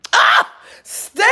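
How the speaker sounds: a sudden high, loud cry of the name; excited shout. Real, not acted. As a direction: call out to her, thrilled. Excited shout to a viewer in chat.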